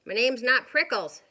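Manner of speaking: loud cross retort; yelled back. Acted. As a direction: yell it back, annoyed. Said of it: "Hank yelled back".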